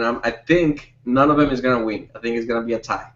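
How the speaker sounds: ordinary talk, nothing marked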